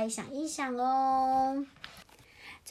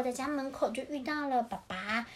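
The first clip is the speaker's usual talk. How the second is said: usual speaking voice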